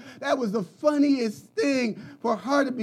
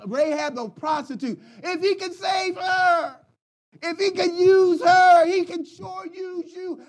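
preaching at full force; 6 semitones higher than usual. Acted. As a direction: bring the sermon home with a cry in the voice. Sermon climax, shouting.

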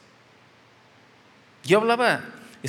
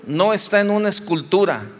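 loud, emphatic repeated "no" at each phrase; fervent preaching; 6 dB louder than usual. Acted. Pastor preaching to his congregation, forceful and emphatic. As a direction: hammer each line home from the pulpit.

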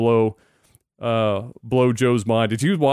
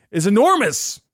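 emphatic amazement; 8 semitones higher than usual. Real, not acted. Host hammers home Musk's impact, emphatic. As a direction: stretch the last word way up high.

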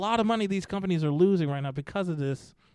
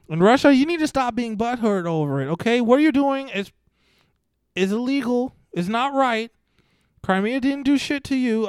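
higher and louder, lecturing Russia; exasperated scolding; 6 semitones higher, 5 dB louder than usual. Real, not acted. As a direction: scold them like a fed-up parent.

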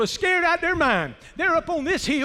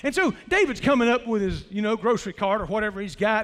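ordinary delivery

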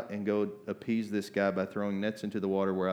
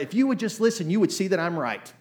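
imitating exasperated parent; 8 semitones higher, 6 dB louder than usual. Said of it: "we're like parents".

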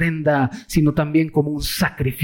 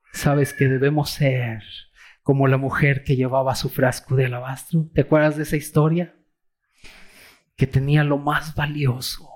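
ordinary talk

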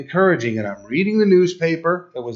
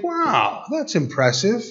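impressed; 8 semitones higher than usual. Retold or imitated. Dad's impressed first thought.

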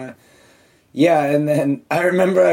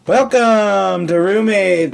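higher, slow, stretched show intro; announcer welcome; 4 semitones higher, 4 dB louder, much slower than usual. Acted. Host opening the podcast, hammed-up announcer. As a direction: welcome listeners like a radio jingle.

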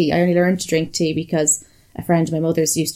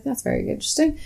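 mock interest; 8 semitones higher, 5 dB quieter than usual. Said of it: "I was like".

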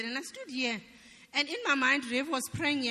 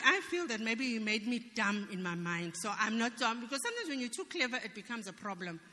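normal talking voice

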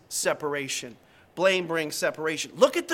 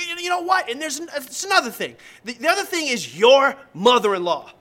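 high, loud angry wife; mock yelling at her husband, pitched up; an angry wife's high yell; retold yelling wife; 5 semitones higher, 6 dB louder than usual. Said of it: "the wife yelling at her husband".

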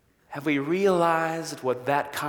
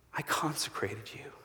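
breathy whisper of God; God whispering to Jeremiah; 4 semitones lower, 13 dB quieter than usual. Acted. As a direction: whisper it like a secret blessing.

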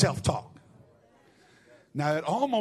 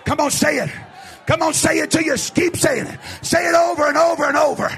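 higher, shouted revival preaching; full-force preaching; 7 semitones higher, 7 dB louder than usual. Real, not acted. Preacher urges the congregation to say it, shouting fervently. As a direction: drive the congregation to declare it, at a shout.

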